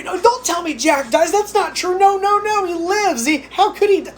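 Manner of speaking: high-pitched pretend denial of a spoiler; hammed-up high-pitched protest; mock disbelief. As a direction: play a viewer refusing the news, voice way up high. Imagined Titanic viewer refusing the spoiler.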